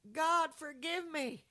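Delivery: high, slow plea to God; calling out to God. Retold or imitated. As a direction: cry out for forgiveness, stretched and pleading. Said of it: "I called out".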